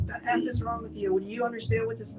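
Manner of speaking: high mock tirade from the parent; a hint of a furious father, played high; imitating angry parent. Parents yelling at her hungover.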